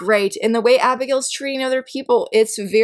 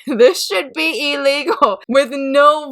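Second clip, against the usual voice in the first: laughing; 5 semitones higher, slower than usual. Real, not acted. Laughing over cliffhanger ending.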